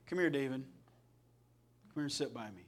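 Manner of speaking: put-on soft, low, tender call to a boy; gentle grandpa call. Grandpa calls young David to sit by him, soft and gentle. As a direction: hush it down like a kind grandpa beckoning.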